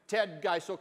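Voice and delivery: plain reading voice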